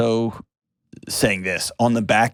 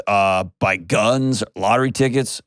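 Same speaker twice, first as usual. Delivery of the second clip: normal talking voice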